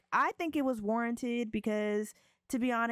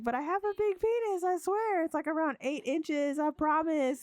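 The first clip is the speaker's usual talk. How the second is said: high, pleading mock voice; 7 semitones higher than usual. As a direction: beg in a squeaky put-on voice. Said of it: "She's like".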